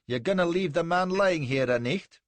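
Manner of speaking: loud, high Scots challenge; strong Scottish accent. A narrator's reading. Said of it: "in a strong Scotch accent".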